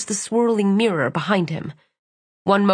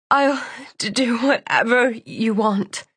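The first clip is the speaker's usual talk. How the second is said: tearful plea; 3 semitones higher, slower than usual. Acted. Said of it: "she whispered".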